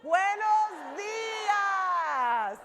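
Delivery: very slow, drawn-out Buenos días, pitched high; loud and proud. Shown as a demonstration. Speaker leading the Spanish speakers' greeting, slow and proud. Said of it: "Okay, Spanish".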